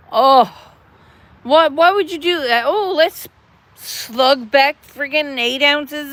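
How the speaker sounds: worked-up scolding, high and loud; agitated rant. Real, not acted. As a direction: scold the viewer in a worried burst. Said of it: "I'm talking too fast".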